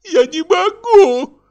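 high, loud, drawn-out wail; theatrical tearful protest; wailing sob. A narrator's reading. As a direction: cry it out slowly in a high voice. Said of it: "зарыдала Каролина".

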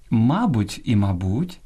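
мабуть stressed first on МА, then on БУТЬ, slow; мабуть shown with first, then last syllable stressed; double stress. Shown as a demonstration. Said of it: "двояке наголошування нормативне".

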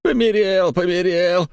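high spiteful squeak mimicking a child; mocking squeaky child voice. A narrator's reading. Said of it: "озлобленно пропищал Михайло, передразнивая тонкий детский голосок".

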